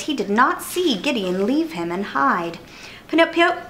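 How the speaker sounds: usual speaking voice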